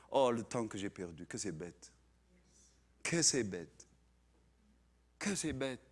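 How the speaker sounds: low, soft lament; mock regret. Repentant believer looking back on lost time, low regretful murmur. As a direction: rue it quietly, pausing between repeats.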